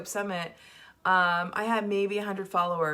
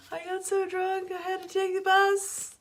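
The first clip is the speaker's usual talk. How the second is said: high-pitched, laughing through the words; real laughter; 9 semitones higher, 8 dB louder than usual. Real, not acted. Retelling a viewer's funny bus post, laughing hard. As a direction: barely get it out for laughing, building louder to the end. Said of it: "you made me laugh so hard".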